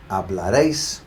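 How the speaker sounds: hablaréis with emphasis on réis; hablaréis, landing firmly on réis; final-syllable stress. Shown as a demonstration. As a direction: punch the last syllable of hablaréis. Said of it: "particular emphasis on the last syllable".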